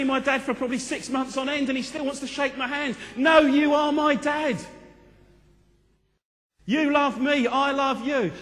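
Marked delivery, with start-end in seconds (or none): 3.2-8.3 loud, high and slow insistence; passionate plea. Acted. Son insisting loudly to his dad, slow and high. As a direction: plead it slowly and loudly from the heart.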